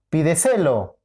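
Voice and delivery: "pídeselo" stressed as pi-de-SE-lo; stress on third syllable. Shown as a demonstration. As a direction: hit the SE of "pídeselo". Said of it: "¿Cuál es?". («pídeselo» con acento en SE: pi-de-SÉ-lo)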